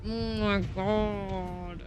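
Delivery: drawn-out queasy groan; grossed-out groan. Real, not acted. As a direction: groan it out slowly in disgust. Recalling a disgusting episode.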